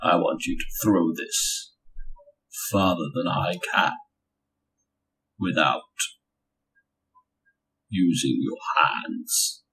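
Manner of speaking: low, soft, halting challenge; Ulfric Stormcloak. Acted. Sorcerer daring a rival to throw a hammer, Ulfric Stormcloak voice. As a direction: drop it deep and quiet, pausing between phrases. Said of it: "Thousand Sons talk like Ulfric Stormcloak".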